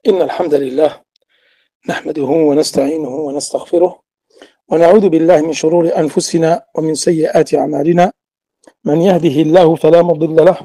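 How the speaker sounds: quick, formulaic Arabic recitation; rapid ritual preamble; recited Arabic opening invocation. Acted.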